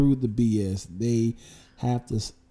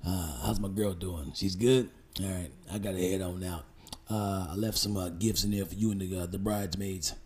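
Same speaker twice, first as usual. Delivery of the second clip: low, soft mock smooth-talker; imitating shady groom; 7 semitones lower, 8 dB quieter, faster than usual. Groom greeting the bride's friend, shady and low. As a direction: keep it low and hushed, casually slippery. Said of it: "He talks like that".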